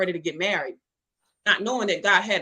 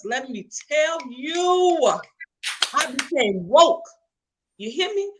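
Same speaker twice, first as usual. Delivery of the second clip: preacher's declaration; 4 semitones higher, slower than usual. Real, not acted. Testifying to the ladies about finding herself, shouting. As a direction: let me tell you shouted slowly, then a triumphant declaration.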